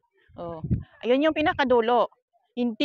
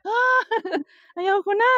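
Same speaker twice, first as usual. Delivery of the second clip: nervous laugh; 9 semitones higher, slower than usual. Real, not acted. Scared on glass walk.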